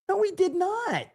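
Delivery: high-pitched "no way" at a headline; incredulous denial. Real, not acted. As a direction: shoot it down in shocked disbelief. Reacting in disbelief to the headline.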